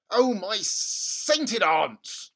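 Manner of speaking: very slow, loud groan of dismay; an appalled dad, slow and loud; dismayed father. Acted. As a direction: draw out the exclamation.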